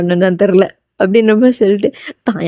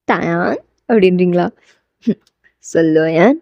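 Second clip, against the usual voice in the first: voiced dialogue; 4 semitones lower than usual. Call and low answer in dialogue.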